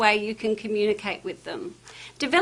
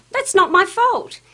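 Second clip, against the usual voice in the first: higher, louder, slow defensive retort; defensive snap; 8 semitones higher, 7 dB louder than usual. Shown as a demonstration. Staff member snapping back at a frustrated customer, loud. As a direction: push the blame away, irritated.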